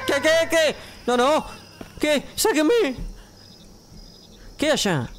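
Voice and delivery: shrieking to be let out in terror; panicked scream. Real, not acted. Startled by a horror-game scare.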